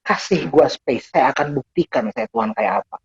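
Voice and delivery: slow, measured voice of God; slow, deliberate divine appeal; God speaking. Acted. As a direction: speak as God, drawing out each phrase. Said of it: "Bahkan dia bilang".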